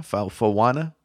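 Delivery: stumble, then try "Fauna" in three syllables, foh-WAH-nuh; unsure pronunciation attempt. Shown as a demonstration. Guessing at the name for co-host, unsure pronunciation attempt. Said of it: "Is that how you pronounce that?".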